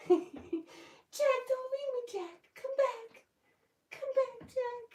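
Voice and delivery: soaring high desperate falsetto plea; hammed-up Rose crying after Jack; Rose from Titanic. Owner reading a viewer's Titanic joke, hammed-up Rose wail. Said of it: "Stitch Love says".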